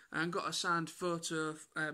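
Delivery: ordinary talk, nothing marked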